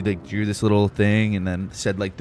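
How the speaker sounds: usual speaking voice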